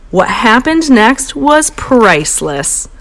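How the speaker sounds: usual speaking voice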